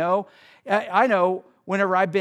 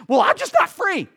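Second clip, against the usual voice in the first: high, louder whiny protest; mock excuse; 10 semitones higher, 7 dB louder than usual. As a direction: mimic a stubborn churchgoer brushing off the truth. Mocking a congregant's excuse.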